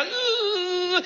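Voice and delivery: a high, whining imitated car noise; car sound. Preacher mimicking the car sliding on the snow, high whine. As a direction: mimic the car groaning as it slides. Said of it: "el carro hacía".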